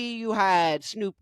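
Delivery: ordinary delivery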